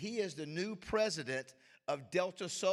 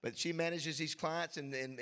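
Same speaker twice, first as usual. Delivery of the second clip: usual speaking voice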